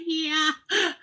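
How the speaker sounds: a shaky laughing yeah; laughing nervously in the dentist chair. Retold or imitated. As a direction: squeeze out a yes through laughter. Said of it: "I go".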